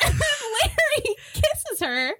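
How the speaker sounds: ordinary talk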